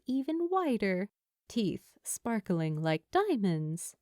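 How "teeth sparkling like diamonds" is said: plain reading voice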